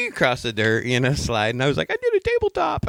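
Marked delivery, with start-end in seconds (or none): 1.9-2.9 very high squeaky voice; mock kid's boast. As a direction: squeal it like a crashed kid. Retells childhood crash boast.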